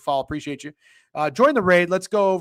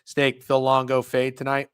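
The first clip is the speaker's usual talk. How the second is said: usual speaking voice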